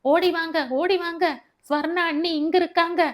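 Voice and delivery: high-pitched call for everyone to come; shouting for help. Acted. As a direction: yell it up the stairs, urgent. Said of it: "கூச்சலிட்டான்". (மாடியிலிருந்து எல்லோரையும் அழைக்கும் உயர்ந்த கூச்சல்)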